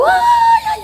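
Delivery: high drawn-out wail imitating a crying boy; mock child crying. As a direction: wail "ay" like a kid in tears. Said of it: "Y el niño llorando".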